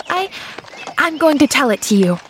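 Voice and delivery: high, quick outburst; stumble, then rush it out decidedly; sudden decision. A narrator's reading. Girl deciding to tell the doctor, bursting out. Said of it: "burst out with sudden decision".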